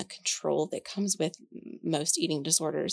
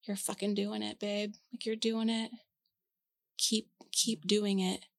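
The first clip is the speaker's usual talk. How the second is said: softer, tender encouragement; sixteen-year-old self to adult self, proud and tender; encouraging; 3 semitones higher, 6 dB quieter than usual. Retold or imitated. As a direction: cheer her on gently, with pride. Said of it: "my sixteen-year-old self would say".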